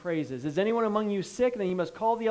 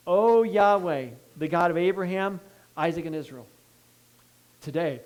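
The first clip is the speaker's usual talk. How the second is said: fervent prayer. Acted. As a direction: call out to God as Elijah, in earnest. Reads Elijah's prayer aloud.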